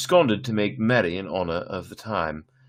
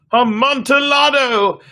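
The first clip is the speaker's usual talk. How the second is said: very high, loud, slow and drawn out; the wine lover's rapture; hammed-up drunken delight; ecstatic cry; 10 dB louder, slower than usual. Fortunato's peak excitement.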